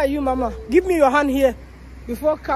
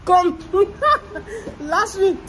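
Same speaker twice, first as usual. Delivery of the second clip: laughing; 8 semitones higher, slower than usual. Real, not acted. Mother laughing while comforting daughter.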